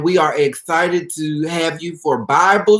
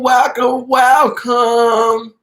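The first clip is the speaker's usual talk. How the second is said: high, loud, sung-out greeting; exuberant welcome; 8 semitones higher, 5 dB louder, much slower than usual. Real, not acted. Host welcoming viewers to the live video, exuberant. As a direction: greet them brightly, pitched well up.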